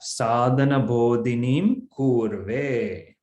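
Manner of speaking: drawn-out Sanskrit recitation; slow recitation. Shown as a demonstration. Teacher starting with the second half of the verse, slow recitation. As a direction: stretch the long syllables as you recite.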